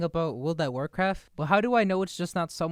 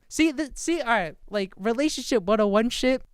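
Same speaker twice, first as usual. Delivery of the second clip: excited outburst; 7 semitones higher, 4 dB louder than usual. Real, not acted. Reacting to ex's messages.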